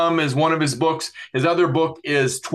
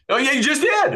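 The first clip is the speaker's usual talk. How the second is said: laughing, much higher-pitched exclamation; amused outburst; 11 semitones higher than usual. Real, not acted. Host teasing his guest over a hand motion joke, laughing. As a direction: crack up as you say it, pitch leaping.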